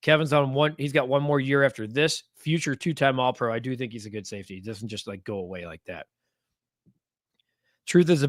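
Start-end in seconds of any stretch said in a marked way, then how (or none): none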